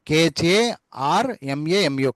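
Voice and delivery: కేహెచ్ఏఆర్ఎంఏఎంయు spelled letter by letter, very slow; slow spelling out. Shown as a demonstration. Teacher dictates the spelling to students, slow letter by letter. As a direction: spell కేహెచ్ఏఆర్ఎంఏఎంయు out slowly for the class. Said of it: "అని చెప్పాలి".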